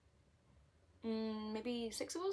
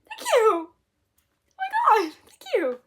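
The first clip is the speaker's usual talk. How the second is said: much higher, loud, slow thrilled squeal; ecstatic squeal; 14 semitones higher, 20 dB louder, much slower than usual. Retold or imitated. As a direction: scream your thanks in delight. Overjoyed at free replacement.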